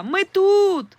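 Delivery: high loud call across the water; shouting for help from the lake. A narrator's reading. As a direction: yell out to the boat for rescue. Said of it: "крикнула я".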